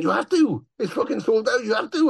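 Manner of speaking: high-pitched, urgent imitation of someone insisting; mock-urgent, pushing someone to go on; insistent retelling. Bandmate insists the show happens.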